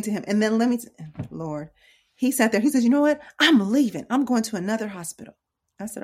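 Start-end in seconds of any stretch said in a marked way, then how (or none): 2.8-5.3 mildly hammed-up frustrated announcement; imitated defiant son. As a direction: announce it in mock defiance, raised in pitch. Voices son threatening to leave.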